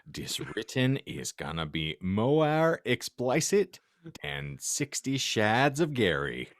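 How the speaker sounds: usual speaking voice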